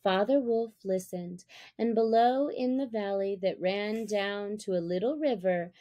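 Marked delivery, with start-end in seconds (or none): none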